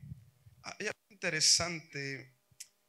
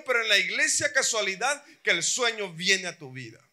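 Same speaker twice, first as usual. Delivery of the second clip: raised, loud sermon challenge; fiery preaching; 5 semitones higher, 9 dB louder than usual. Acted. Preacher scolding drowsy churchgoers, loud and fiery. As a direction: preach it hard at the sleepers. Preacher confronting sleepy congregation.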